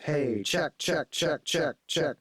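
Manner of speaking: slapback echo on the voice; short echo doubling each word; slapback effect. Shown as a demonstration. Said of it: "which sounds like this".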